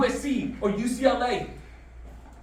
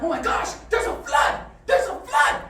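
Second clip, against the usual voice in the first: high-pitched panicky scorpion; story-voice alarm, pitched up; mock alarm; 6 semitones higher than usual. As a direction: cry out a hammed-up warning.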